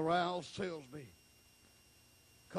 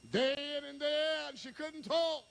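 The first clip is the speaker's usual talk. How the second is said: preaching at full force; 12 semitones higher than usual. Acted.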